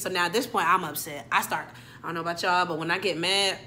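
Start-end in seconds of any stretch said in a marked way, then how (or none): none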